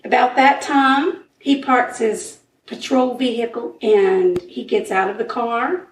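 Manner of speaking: usual speaking voice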